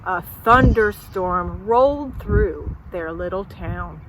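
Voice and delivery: ordinary delivery, nothing marked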